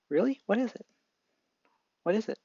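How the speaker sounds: high, bouncy excited questions; eager kid. Acted. Deku begs the doctor to tell him his quirk, high eager voice.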